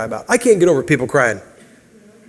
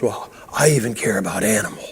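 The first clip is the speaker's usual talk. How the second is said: whispered, much lower and softer; mock whisper; 7 semitones lower, 8 dB quieter than usual. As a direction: whisper it like a guilty confession. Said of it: "And so you go".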